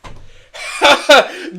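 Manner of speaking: loud high cackle; evil gloating cackle; over-the-top baddie laugh; villain laugh.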